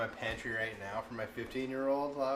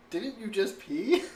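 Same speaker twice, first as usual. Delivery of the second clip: much higher and louder, slow disbelief; incredulous question; 10 semitones higher, 8 dB louder, slower than usual. Retold or imitated. Mom retelling asking her toddler, in loud disbelief. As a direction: act floored that he peed again. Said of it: "I'm like".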